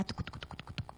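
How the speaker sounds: whispered rapid adrenaline pulse; very soft chugging noise; imitated pounding. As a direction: patter it voicelessly, like a racing engine. Imitates adrenaline pumping again.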